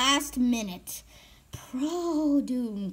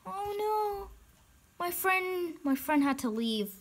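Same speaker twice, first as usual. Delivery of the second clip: soft, sinking lament; dejected; 4 semitones lower, 5 dB quieter, slower than usual. Real, not acted. Player whose friend had to leave, soft and dejected. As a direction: trail down lower and quieter, let down. Friend has left the game.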